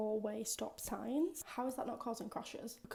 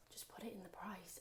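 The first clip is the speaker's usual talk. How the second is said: softer whispered complaint; exasperated whisper; 2 semitones lower, 9 dB quieter than usual. Real, not acted. Woman complains about sales tax in a whisper. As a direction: whisper it like a secret grumble.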